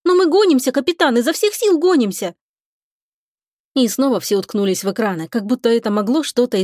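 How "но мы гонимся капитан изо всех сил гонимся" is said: guilty pleading. A narrator's reading. Lika excuses herself to the captain, guilty and pleading. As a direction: insist apologetically, pitch well up. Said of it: "виновато ответила Лика".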